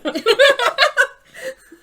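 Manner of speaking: high, loud burst of laughter. Real, not acted. Laughing at British characters.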